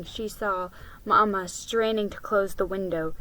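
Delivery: plain reading voice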